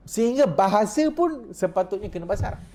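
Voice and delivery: animated preacher stressing pun; emphatic. Real, not acted. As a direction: push the point up high and eager. Emphatic point, then laughing repeat.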